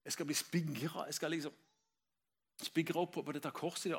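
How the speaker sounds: hushed, halting inner voice; soft, broken-up acted dread; condemned man's thoughts. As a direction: murmur it as a prisoner dreading death. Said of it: "Han tenker".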